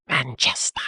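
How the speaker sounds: drawn-out whisper in a put-on English accent; mock-English whisper. American savouring an English city's name, mock-English whisper.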